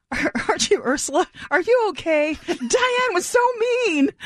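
high, sympathetic stranger voice; concerned fan. Retold or imitated. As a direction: pitch it up like a worried fan. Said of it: "someone stopped me and said".

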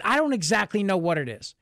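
ordinary delivery

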